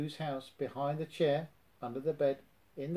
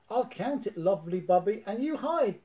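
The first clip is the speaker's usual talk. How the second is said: much higher, loud, bouncy tiger voice; bouncy Tigger; 7 semitones higher, 4 dB louder than usual. A narrator's reading. Tigger starts hide and seek with Roo, bouncy. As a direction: bound into it like Tigger. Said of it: "Tigger said to Roo".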